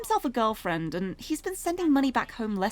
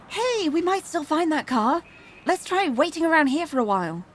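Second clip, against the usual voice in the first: encouraging, a little higher and louder; lightly voiced cheering up; 5 semitones higher, 5 dB louder than usual. Achi cheers Hitomi up outside the shop, lightly acted encouragement. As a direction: lift a friend's spirits, lightly acted.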